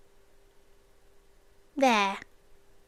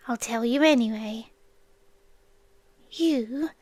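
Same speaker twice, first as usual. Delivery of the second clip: ordinary talk, nothing marked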